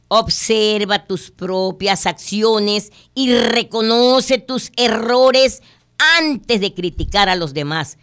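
very slow, deliberate reading of the moral; moral stated slowly. Shown as a demonstration. Host reading the fable's moral slowly. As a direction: weigh each word. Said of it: "La moraleja".

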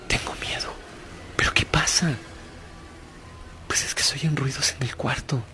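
whispered softly, afraid; soft fearful whisper to dad; scared child whisper. Retold or imitated. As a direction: breathe it like a frightened child at night. Frightened girl wakes her father.

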